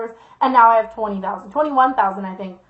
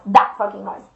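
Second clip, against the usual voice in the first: usual speaking voice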